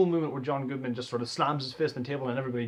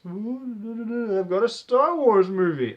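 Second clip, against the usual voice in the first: showman voice; 5 semitones higher, 5 dB louder than usual. Retold or imitated. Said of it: "a magician to the end".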